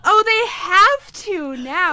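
slow, loud, swooning; swooning gush. Acted. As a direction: gush it out like a lovesick fan. Third swoon take.

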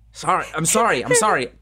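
usual speaking voice

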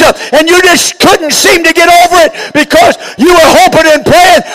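loud, high, driving sermon shout; full-force preaching. Real, not acted. Preacher urging the congregation to find the light, full-force preaching.

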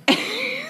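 high, soft real laugh; coping laugh. Real, not acted. Joking to cope with dark news, laughing. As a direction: let out a high, helpless little laugh. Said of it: "I'm laughing because I joke to cope".

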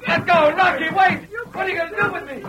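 high, desperate yell; terrified shout. Acted. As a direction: panic as they drag you away. Man dragged off by posse.

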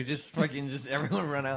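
normal talking voice

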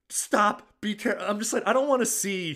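high-pitched, imitating a woman in discomfort; mock pained protest. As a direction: voice lifted high in retold alarm. Retold woman's protest during painful sex.

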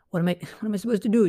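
high, fast and flustered. Retold or imitated. Child stuck between dad and errand, fast and flustered. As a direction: rush it out in a panic. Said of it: "you're like".

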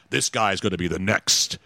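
low, drawn-out trailer announcer; movie trailer voice. Acted. Host mimicking the late movie trailer guy, low announcer. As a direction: drop deep for a film trailer line. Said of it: "you know, the movie guy".

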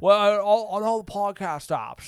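stammering old movie star impression; Jimmy Stewart. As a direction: hem and haw like an old Hollywood actor.

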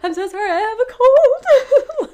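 high, loud laughing excuse; flustered laughing apology. Retold or imitated. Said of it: "I'm like".

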